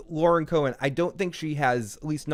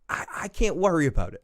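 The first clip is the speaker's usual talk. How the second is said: usual speaking voice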